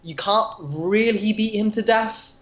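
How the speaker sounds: ordinary talk, nothing marked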